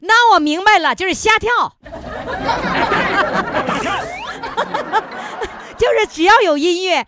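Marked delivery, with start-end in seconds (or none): 1.5-5.7 long falling burst of laughter. Real, not acted. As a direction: laugh hard at your own joke. Host laughs at 瞎跳. (哈哈大笑)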